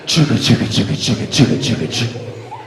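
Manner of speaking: loud chugga chugga, rhythmic and punchy; train chugging. Retold or imitated. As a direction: chug like a train charging in. Brother running like a train.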